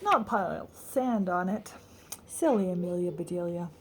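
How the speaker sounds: ordinary delivery, nothing marked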